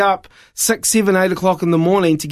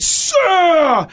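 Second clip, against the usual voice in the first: retold shout; 10 semitones higher than usual. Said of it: "And he went".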